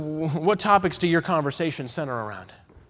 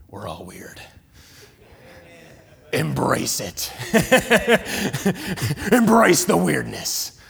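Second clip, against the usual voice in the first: mock deep voice; 3 semitones lower, slower than usual. Joking about weirdness.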